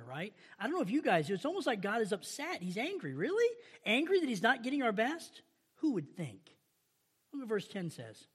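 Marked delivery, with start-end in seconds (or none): 3.1-6.4 high sarcastic "Really?". Pretend. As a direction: drip with mock disbelief. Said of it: "I read the sarcasm in here".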